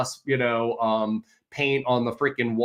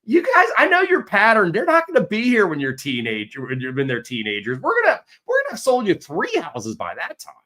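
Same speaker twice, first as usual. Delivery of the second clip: much higher, louder ribbing; excited teasing; 8 semitones higher, 5 dB louder than usual. Retold or imitated. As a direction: tease a client who moves often. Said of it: "I'm like".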